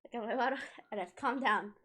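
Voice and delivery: usual speaking voice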